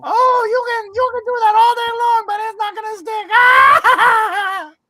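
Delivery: loud, very high mocking creature voice; Salacious Crumb. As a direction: scoff in a squealing imp voice. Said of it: "It's like Salacious Crumb".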